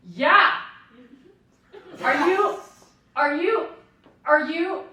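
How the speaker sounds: yelled through car window. Retold or imitated. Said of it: "I put down the windows and I said".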